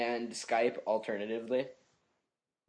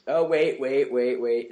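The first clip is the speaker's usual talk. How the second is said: loud sudden "oh wait"; excited; 8 dB louder, slower than usual. Real, not acted. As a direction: blurt it out, stopping him.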